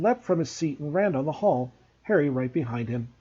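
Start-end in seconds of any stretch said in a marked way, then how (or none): none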